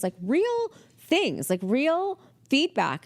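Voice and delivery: "real" stretched out high and slow; emphatic REAL. Real, not acted. Telling how serious the boss's feedback was, "real" drawn out high. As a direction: hold and lift "real" both times.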